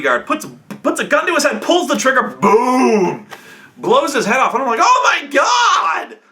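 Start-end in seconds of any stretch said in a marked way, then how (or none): 2.2-3.3 loud, high, drawn-out boom; imitated gunshot. 4.8-6.2 high, drawn-out shout of horror; shocked shout. Retold or imitated. Reacting to the shooting, retold.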